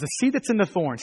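ordinary delivery

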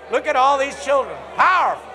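high, loud delighted cheer; excited shout. Real, not acted. Pastor thrilled by children praising, shouting with delight.

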